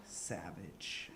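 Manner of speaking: very soft low mutter; mumbled. Retold or imitated. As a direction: mutter it under your breath. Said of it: "he was like".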